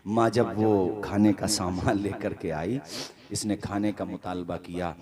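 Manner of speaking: low, soft, grieving narration; hushed sad storytelling. Acted. Preacher tells the ruined son's fall, hushed and grieving. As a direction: draw them into the son's downfall quietly.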